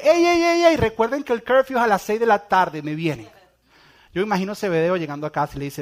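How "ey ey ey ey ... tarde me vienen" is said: mock fatherly call. Zebedeo calling after his sons.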